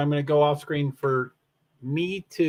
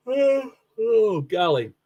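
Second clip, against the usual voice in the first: speaking through a yawn; 6 semitones higher, slower than usual. Real, not acted. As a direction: yawn the words out. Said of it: "I apologize for the yawning".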